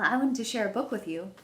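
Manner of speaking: ordinary delivery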